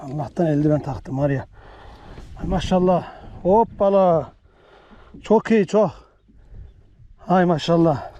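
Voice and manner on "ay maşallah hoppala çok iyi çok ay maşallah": excited exclamations. Real, not acted. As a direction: gush over the catch in real delight. Holding up the big fish.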